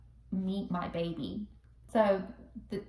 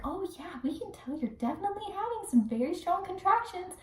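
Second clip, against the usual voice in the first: high-pitched nurse voice; put-on high, sweet delivery; imitated nurse; 10 semitones higher than usual. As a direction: mimic a nurse stating the obvious. Acts nurses reading the monitor.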